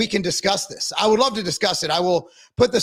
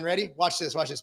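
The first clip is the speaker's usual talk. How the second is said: ordinary talk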